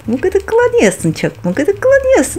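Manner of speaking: high, playful teasing. Real, not acted. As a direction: tease in a sing-song lilt. Mother teasing the tickled doll.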